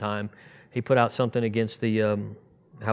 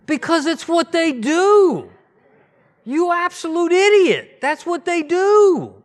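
high, loud, slow mock-yell at an idiot; mock furious outburst, drawn out; exasperated retort; 10 dB louder, slower than usual. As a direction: spit it out in exasperated disbelief. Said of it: "I was like".

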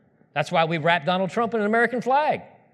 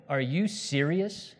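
slow, softer mock disbelief; drawn-out, underwhelmed question; incredulous; 2 semitones lower, 6 dB quieter, much slower than usual. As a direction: size up a feeble threat. Acts scornful disbelief at the devil.

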